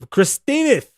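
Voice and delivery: high, loud sing-song name; teasing mock-fancy name; playful name call. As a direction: say the name with a flourish.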